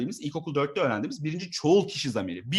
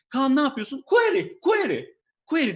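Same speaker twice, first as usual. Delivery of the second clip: high, loud squeaky mock shout of query; mock manager exchange; 10 semitones higher, 6 dB louder than usual. Comedian squeals query to drive away his manager. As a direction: shriek query to scare the boss off.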